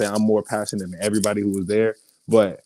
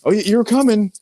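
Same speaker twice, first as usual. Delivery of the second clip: eager recruiter; 13 semitones higher, 4 dB louder than usual. Pretend. Mimicking eager Piedmont recruiter.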